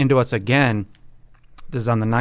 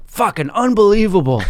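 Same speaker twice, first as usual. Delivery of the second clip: high, louder burst of indignation; mock exasperation; 9 semitones higher, 4 dB louder than usual. Retelling friend's reaction to the golfer's excuse, mock exasperated. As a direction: imitate a friend who can't believe it.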